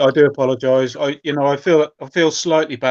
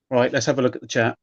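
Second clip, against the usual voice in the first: usual speaking voice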